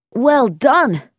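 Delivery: praise. A narrator's reading. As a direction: cheer the good shot loudly. Bobby praises friend's shot.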